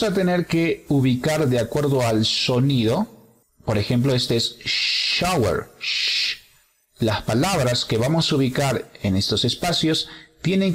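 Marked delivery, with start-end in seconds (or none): 4.6-5.7 "shower" with a long hushed opening; drawn-out sh. Shown as a demonstration. Teacher showing the sound, "shower" with lengthened sh. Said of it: "que tener este sonido". 5.8-6.4 long hushing "Sh."; sh sound. Shown as a demonstration. Teacher isolating the sound from shower, "Sh." as long hush. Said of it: "este sonido".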